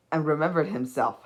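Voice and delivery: plain reading voice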